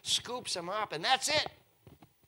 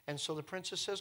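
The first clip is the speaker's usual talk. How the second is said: usual speaking voice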